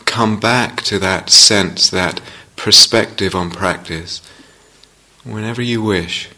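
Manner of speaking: unhurried meditation guidance with long pauses; guided meditation. Real, not acted. Teacher settling meditators into the felt sense of the body, guided meditation. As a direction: ease them gently into practice, leaving space between phrases.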